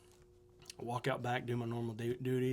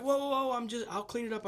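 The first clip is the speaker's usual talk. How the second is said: higher, hurried plea to calm someone down; a man caught in a dumpster begging not to be thrown out; startled pleading; 7 semitones higher than usual. Retold or imitated. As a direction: back off quickly, promising to behave.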